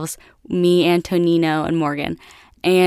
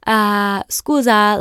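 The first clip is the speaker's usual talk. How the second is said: higher-pitched Italian interjection; mock Italian accent, pitched up; imitated Italian man; 4 semitones higher, 3 dB louder, much slower than usual. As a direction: put on an Italian father's voice. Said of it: "this big Italian guy".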